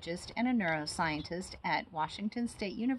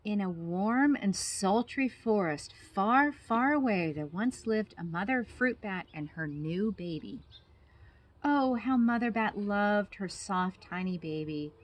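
put-on lively storybook narration for kids; reading to children; 3 semitones higher, 7 dB louder than usual. A narrator's reading. Biologist reading a bat story to children, animated. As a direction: read it like bedtime story time. Reading a picture book aloud.

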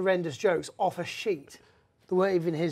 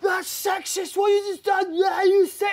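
high, loud mock drunk woman; drunk woman heckler; 12 semitones higher, 6 dB louder than usual.

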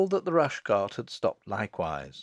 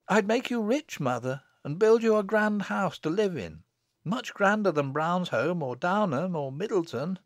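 put-on high-pitched village girl promising; eager daughter; 8 semitones higher than usual. Daughter promising riches to her mother, high voice. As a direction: list promises in a high girlish voice.

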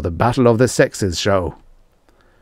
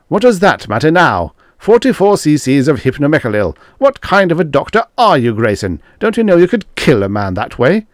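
high, loud scolding questions; exasperated reproach; 8 semitones higher, 6 dB louder than usual. A narrator's reading. Groaning Manford berating Grayson over the deadly dose, exasperated. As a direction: berate an incompetent colleague.

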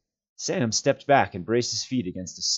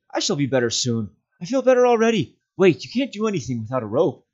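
a touch steadier, raised voice; Frodo more strongly; 10 semitones higher, 6 dB louder than usual. Acted. Frodo reassuring Sam from below, steadier call. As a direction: call up with returning strength. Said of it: "more strongly now".

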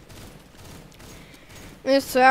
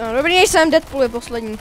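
ordinary delivery

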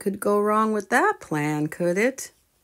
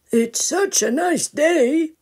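cheerful grandpa; 10 semitones higher, 4 dB louder, slower than usual. Acted. Grandpa proposes picnic.

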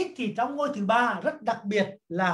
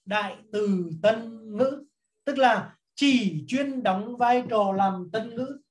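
slow, deliberate dictation pace; teacher dictating notes slowly; much slower than usual. Shown as a demonstration. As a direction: dictate it slowly so students can copy. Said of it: "tôi viết tắt".

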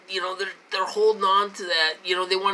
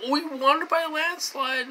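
much higher, sneering parody of a bragger; mock the boast in a higher voice; 10 semitones higher than usual. Mocking Trump's boast.

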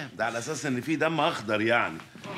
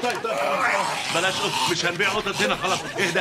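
high, loud placating yell; frantic shout; 7 semitones higher, 4 dB louder than usual. Acted. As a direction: shout to calm him down. Giving in to a raging friend.

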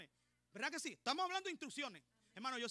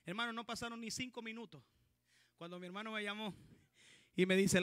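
ordinary delivery

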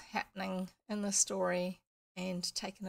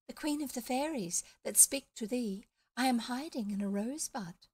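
fairy queen voice; 4 semitones higher than usual. Acted. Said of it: "small, high voice, like a little girl".

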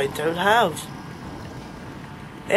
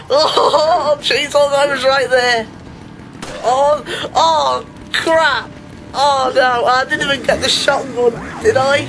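rattled; 6 semitones higher, slower than usual. Real, not acted. Player just escaped chainsaw guy, rattled. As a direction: wail it, still shaken by the fright. Shaken after narrow escape.

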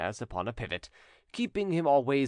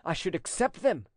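raised, lively exclamation; exclaiming notary; 7 semitones higher than usual. A narrator's reading. Notary answers the widow's hypothetical offer, lively cry. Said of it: "cried the notary".

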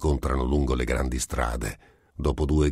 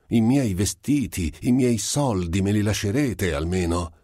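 put-on pleading like a child; 6 semitones higher than usual. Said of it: "supplicava il magistrato come un bambino".

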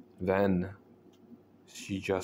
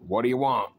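loud startled holler; 4 semitones higher, 5 dB louder, faster than usual. A narrator's reading. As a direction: holler it out in fright. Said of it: "he jumped up and hollered".

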